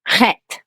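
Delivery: "chet." with a rough throaty h at the start, like Arabic ḥ; Hebrew chet sound. Shown as a demonstration. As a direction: say "chet." with a breathy guttural h, not a k. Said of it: "let me see if I pronounce it okayish".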